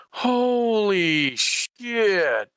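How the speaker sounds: slow, drawn-out high gasp of awe; breathless wonder at the view; stunned awe. Acted. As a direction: let it hang, amazed. Awed at the view again.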